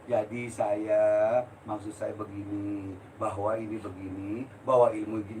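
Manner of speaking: slow, soft, polite mock-refined speech; mock calm talk. Shown as a demonstration. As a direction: imitate a gentle, measured speaker. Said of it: "nggak bisa kalem".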